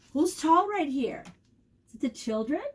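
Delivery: ordinary delivery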